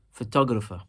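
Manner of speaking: "photographer" with TOG stressed, then falling; stress on second syllable. Shown as a demonstration. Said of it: "we are also emphasizing the second syllable".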